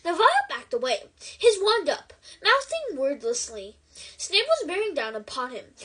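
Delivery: usual speaking voice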